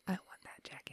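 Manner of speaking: breathy little whisper of wanting; covetous whisper. Retold or imitated. She whispers how badly she wants the jacket. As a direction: whisper it like a secret wish.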